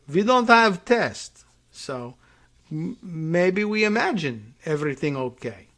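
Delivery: put-on deliberately clumsy Russian accent, drawn out; bad Russian accent. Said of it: "My Russian accent is probably terrible".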